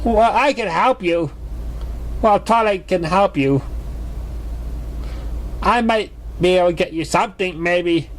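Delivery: unsure, half-offering help; muttered offer. Acted. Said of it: "Picky muttered".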